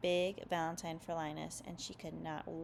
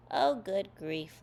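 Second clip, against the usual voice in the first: plain reading voice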